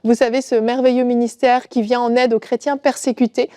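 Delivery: ordinary talk, nothing marked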